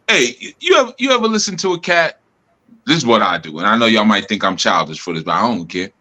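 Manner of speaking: usual speaking voice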